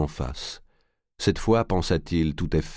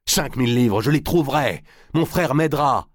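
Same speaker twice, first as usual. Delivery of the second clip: high, pressing, raised voice; agitated, higher-pitched promise; desperate insistence; 9 semitones higher, 4 dB louder than usual. A narrator's reading. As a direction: insist on the offer as if cornered. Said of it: "les poings serrés, répéta".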